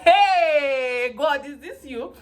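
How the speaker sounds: hammed-up despairing appeal to God; dramatic plea. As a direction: wail it up to God in mock despair. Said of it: "I can be very dramatic".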